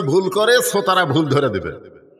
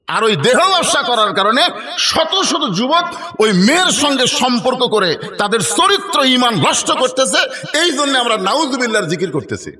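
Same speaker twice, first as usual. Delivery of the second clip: high, swooping, indignant retelling; impassioned preaching; 6 semitones higher than usual. Retold or imitated. Disciples explain their protest to their master, impassioned high preaching.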